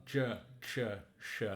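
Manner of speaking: "J, Ch, Sh" with the tongue curled back; retroflex sounds. Shown as a demonstration. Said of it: "give it a shape like this".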